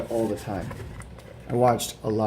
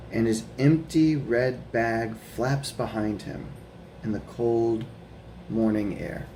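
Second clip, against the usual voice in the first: gentle storybook read-aloud; reading to children; slower than usual. Acted. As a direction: read it like a bedtime picture book. Reading picture book aloud.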